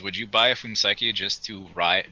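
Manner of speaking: normal talking voice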